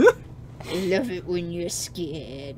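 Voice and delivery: slow, drawn-out creepy man. Acted. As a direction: lurk and savour every word slowly. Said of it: "a creepy man voice".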